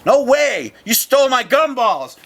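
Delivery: high, loud, crying yell; defiant yell. Retold or imitated. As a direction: scream it like a furious kid. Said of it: "I'm like".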